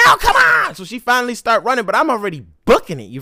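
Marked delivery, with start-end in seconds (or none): 0.1-0.8 high, loud shout; retold scream calling the dog. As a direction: yell at the dog to come. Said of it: "So I scream at her".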